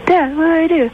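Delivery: high, pleading son voice; clueless son. Acted. Son on a walkie-talkie asks Dad for help, high and helpless. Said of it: "And then he goes".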